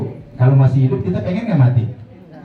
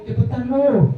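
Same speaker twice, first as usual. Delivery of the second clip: high-pitched teasing call; playful beckoning; 9 semitones higher than usual. Pretend. Dead believer inviting the living to follow, playful high call. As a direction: urge them over, pitched high. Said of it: "Akhirnya nawarin lagi".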